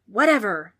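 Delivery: loud, dismissive wail; wailing ghost. A narrator's reading. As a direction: wail it out, fed up. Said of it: "wailed the ghost".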